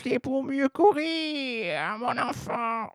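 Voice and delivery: high, slow, soft sly voice; sly granny wolf. Acted. Wolf answering the girl, high slow sly granny voice. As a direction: draw it out, sly and sweet.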